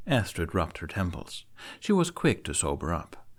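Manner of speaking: ordinary delivery, nothing marked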